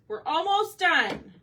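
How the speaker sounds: drawn out, loud and high; excited yell. Real, not acted. Crafter cheering on viewers as the painting nears its end, excited yell. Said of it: "I just yelled that".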